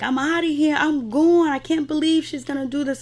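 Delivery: high-pitched retold huff; mock exasperated exit. Acting out B J leaving the fight, mock exasperated. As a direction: imitate someone announcing she is storming off. Acts out B J storming off.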